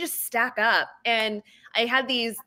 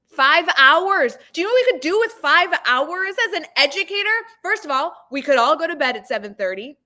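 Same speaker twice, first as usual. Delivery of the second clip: excited emphasis; 6 semitones higher, 4 dB louder than usual. Real, not acted. Enthusing about saved time.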